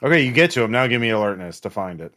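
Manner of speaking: ordinary talk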